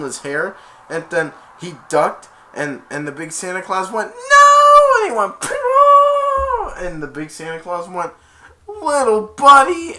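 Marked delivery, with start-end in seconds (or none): 4.1-5.0 very high, loud mock scream; long "no" wail. Big Santa seeing his little buddy punched, mock wail. 5.4-6.7 long, loud imitated cry; high mock scream. Fighter in the Santa brawl, piercing mock cry. 8.7-10.0 high, drawn-out loud mock cry; distraught big Santa. Big Santa over his punched friend, mock anguish.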